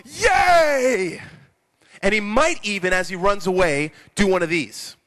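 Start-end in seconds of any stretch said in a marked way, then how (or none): 0.1-1.2 high, loud, drawn-out yay; hammed-up whoop of a forgiven man; jubilant cheer. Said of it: "jumps up and he goes".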